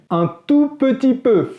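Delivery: high, slow, mock-modest aside. Real, not acted. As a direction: play down the effort, tongue in cheek. Ironic understatement about his research.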